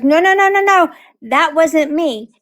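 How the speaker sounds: high, frantic denial, slowing down; panicked denial. Retold or imitated. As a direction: squeal out no, no, no, then drag it. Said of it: "And Brittany goes".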